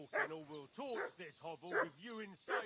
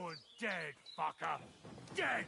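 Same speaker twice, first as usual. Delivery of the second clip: roaring a death threat; furious shout; 3 semitones lower, 3 dB louder than usual. Acted. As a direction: bellow it at the enemy. Bandit attacks.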